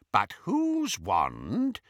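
slow, probing question; curious. A narrator's reading. Travers quizzing Bellatrix about her wand, curiously. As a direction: draw the question out with mild curiosity. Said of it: "said Travers curiously".

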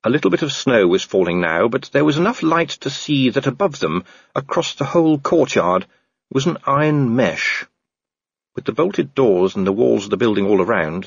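ordinary delivery

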